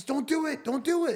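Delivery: high, urgent don't do it; hippo pleading. Acted. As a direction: beg in a squeaky voice. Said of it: "the hippopotamus says".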